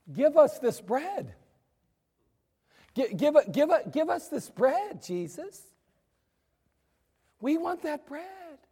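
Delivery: hammed-up whiny plea; whiny begging crowd. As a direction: beg for bread like a greedy crowd. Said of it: "Why are you talking like that?".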